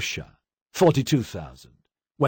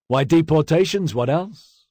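higher, louder answer ending on a questioning lift; offhand reply; 7 semitones higher, 5 dB louder than usual. A narrator's reading. Drunk commissar answering across the table, high offhand reply. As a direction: answer as if it were obvious, pitched up.

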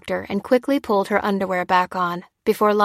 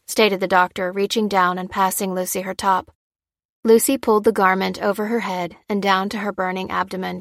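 ordinary delivery, nothing marked

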